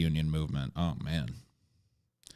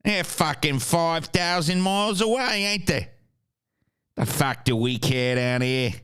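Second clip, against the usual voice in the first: put-on Australian, rising higher partway through; Aussie accent; 4 semitones higher than usual. Imitating an Aussie unbothered by Russia, broad Australian accent. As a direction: shrug it off as a mock Aussie. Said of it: "Only that Aussie can be like".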